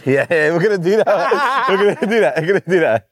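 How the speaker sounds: high-pitched, giggly agreement; gleeful laughing. Real, not acted. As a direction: say it through delighted laughter. Cracking up over prank sentence.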